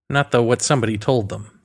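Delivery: usual speaking voice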